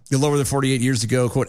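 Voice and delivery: ordinary talk, nothing marked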